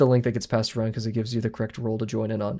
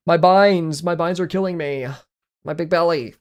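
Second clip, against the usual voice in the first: loud, higher comic anguish; pained yelling; 6 semitones higher, 5 dB louder than usual. Real, not acted. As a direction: cry out in dramatic pain. Keybinds hurting, mock pain.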